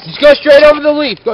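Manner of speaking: high, loud called-out direction; shouting instructions. Real, not acted. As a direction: yell it to the rider. Shouting instructions across a skatepark.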